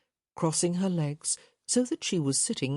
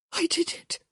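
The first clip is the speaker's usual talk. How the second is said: usual speaking voice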